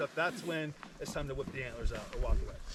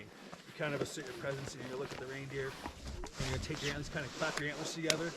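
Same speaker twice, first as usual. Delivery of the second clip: ordinary talk, nothing marked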